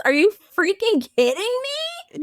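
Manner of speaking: high-pitched incredulous outrage at normal volume; mock pissed off. As the jilted butler confronting Mary, mock pissed off. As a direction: protest it in acted disbelief, pitch jumping up. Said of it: "I would be so pissed off".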